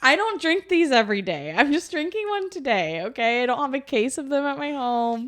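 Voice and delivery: mock indignant defense; defensive retort. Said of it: "And I'm like".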